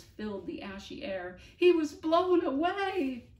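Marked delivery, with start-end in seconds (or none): 1.6-3.3 Bonaparte's slow, high, loud howl; howling grief. Acted. Bonaparte howling to the others that the dog is gone, slow and high. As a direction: howl out the loss.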